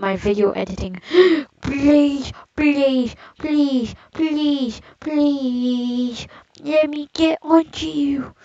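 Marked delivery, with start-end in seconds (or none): 1.1-1.6 high loud startled gasp; sharp gasp. Real, not acted. Sudden gasp at game danger. 1.6-8.3 excited begging. Real, not acted. Chasing a cell, begging.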